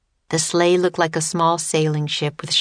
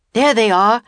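loud, raised-pitch sighting call; urgent storybook shout; alarmed cry; 5 semitones higher, 6 dB louder than usual. Acted. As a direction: call out the danger. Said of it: "cried Teddy".

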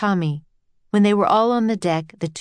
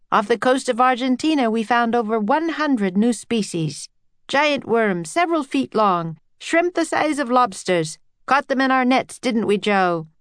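enthusiastic scientist, higher pitch; proud explorer recounting discoveries; Henry; 6 semitones higher than usual. A narrator's reading. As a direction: list the finds with lifted voice. Voices Henry in story.

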